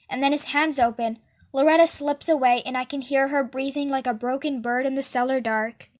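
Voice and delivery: ordinary delivery, nothing marked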